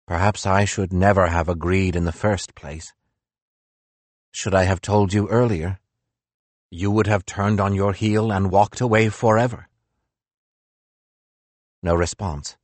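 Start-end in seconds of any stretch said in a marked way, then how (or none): none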